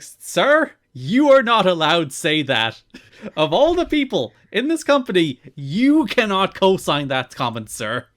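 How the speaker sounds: high, loud outraged protest; mock-scold him like an offended official; indignant objection. Wrestler scolding the announcer, a loud indignant protest.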